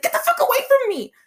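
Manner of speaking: high, fast, loud snap; retold yell. Woman yelling at a recruiter chasing her outside. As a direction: shout him off, fast and high. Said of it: "And I was like".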